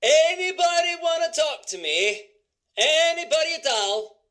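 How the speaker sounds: high, sustained call for company. Acted. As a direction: call out to anyone who might listen. Calling out for someone to talk.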